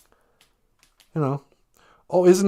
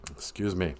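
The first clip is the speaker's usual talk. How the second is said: ordinary delivery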